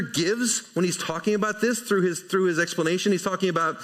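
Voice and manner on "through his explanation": usual speaking voice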